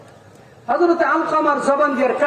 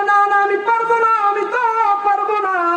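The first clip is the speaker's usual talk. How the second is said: high, pleading refusal; mother refusing to forgive her son; 9 semitones higher than usual. Acted. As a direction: cry it out as the hurt mother.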